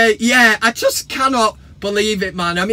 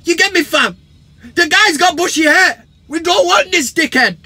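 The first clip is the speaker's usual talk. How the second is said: high, loud fan-cam rant; hammed-up indignant supporter; mock Arsenal fan; 7 semitones higher, 6 dB louder than usual. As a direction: rant like an outraged football fan. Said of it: "Fan T V will be absolutely sensational".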